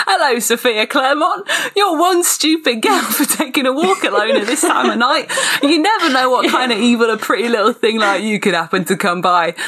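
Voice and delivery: high, laughing British villain; hammed-up British menace, on the edge of giggles; British accent. Reader voicing the sinister stranger to co-hosts, laughing British accent. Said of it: "I need to do British".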